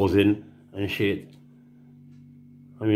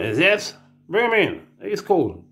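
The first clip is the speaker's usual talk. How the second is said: lightly raised voice of an approving official; mock official; 8 semitones higher, slower than usual. Official waving him through, lightly mocking tone. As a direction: toss it off like a cheerful bureaucrat.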